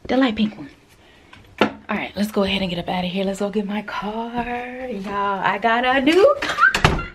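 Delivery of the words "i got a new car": higher, louder, slow reveal; excited announcement. Real, not acted. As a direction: show off the big news. Announcing new car.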